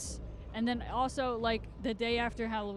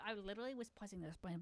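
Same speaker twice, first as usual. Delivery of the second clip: muttered repeat; 10 dB quieter than usual. Real, not acted.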